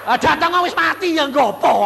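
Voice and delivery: high, loud exasperated cry; loud retort. Retold or imitated. Preacher arguing with friends about going home, high loud retort. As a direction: fling it back at them. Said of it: "aku bilang".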